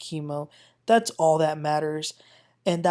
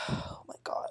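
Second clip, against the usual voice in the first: breathy whispered exasperation; sighed whisper; 15 dB quieter than usual. Real, not acted. Recapper sighing about Nicole, whispered. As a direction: let it out on a tired sigh.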